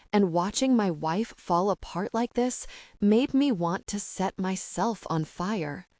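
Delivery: ordinary delivery, nothing marked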